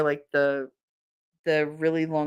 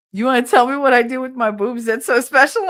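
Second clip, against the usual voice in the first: mock indignant; 6 semitones higher, 6 dB louder than usual. Wife challenging husband.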